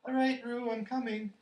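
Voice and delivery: high, loud shout across distance; calling out. A narrator's reading. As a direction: call urgently to reassure. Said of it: "called Christopher Robin".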